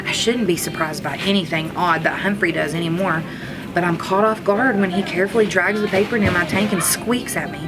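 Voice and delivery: ordinary delivery, nothing marked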